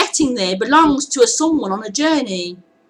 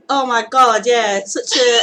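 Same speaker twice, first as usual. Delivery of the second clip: usual speaking voice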